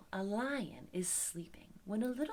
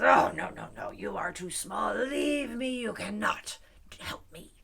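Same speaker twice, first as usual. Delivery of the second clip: rough, louder lion voice; dismissive lion; 6 dB louder than usual. Acted. Trapped lion refuses mouse's help, dismissive lion. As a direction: groan and wave the mouse away. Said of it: "The lion says".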